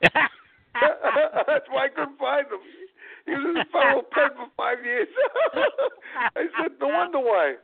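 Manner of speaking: high-pitched, helpless laughter while telling the punchline; laughing. Real, not acted. Laughing at his own punchline.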